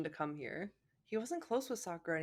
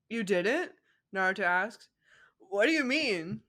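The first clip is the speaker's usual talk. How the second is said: lightly voiced dumb Naruto; 6 dB louder than usual. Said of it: "how dumb he still sounds".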